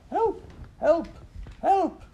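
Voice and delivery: very high, loud yelping cries; a terrified dog yelping for help; frantic cry for help. Acted. Dog at the back door crying out in terror. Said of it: "he cried outside the back door".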